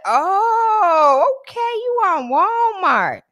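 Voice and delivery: high, slow mock unimpressed; unimpressed teen. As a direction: drag it out like it's no big deal. Daughter's unimpressed reply.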